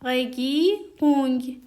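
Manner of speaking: دی بوندس رگیرونگ slow and clear; slow careful teaching read; slow demo. Shown as a demonstration.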